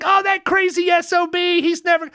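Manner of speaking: high-pitched, animated outburst as the other guy; retold exclamation. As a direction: act out delighted recognition of a local character. Retells stranger's outburst about mutual acquaintance.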